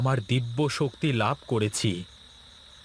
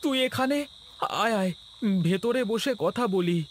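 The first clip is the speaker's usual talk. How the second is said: put-on a much higher, friendly young man's voice; higher voiced Gopal; 11 semitones higher than usual. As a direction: greet him high and eager. (উঁচু গলায় গোপাল)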